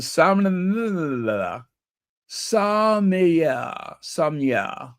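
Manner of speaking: slow, tongue-tied "sommelier", slurred towards "som-nuh-la"; fumbled pronunciation. Real, not acted. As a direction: stretch "sommelier" into "sah-mee-yah". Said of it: "how the hell do you pronounce that".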